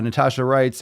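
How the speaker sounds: ordinary talk, nothing marked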